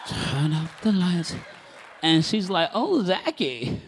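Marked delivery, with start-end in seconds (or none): 0.1-1.3 slow, drawn-out croon of the title; playfully sultry; mock seductive. Teasing echo of love song title. 2.7-3.8 slow, high, coy wife; playfully swooning; mock flirty. Wife flirting back in the story.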